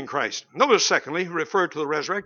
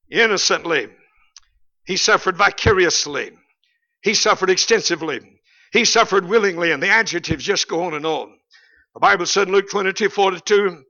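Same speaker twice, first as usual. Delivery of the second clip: ordinary delivery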